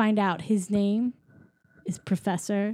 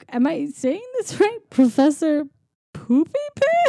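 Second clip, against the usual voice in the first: imitating someone fighting back a chuckle, pitch climbing; chuckling; 7 semitones higher than usual. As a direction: read out the name in high, mock-amused disbelief. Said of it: "she starts just, like, chuckling".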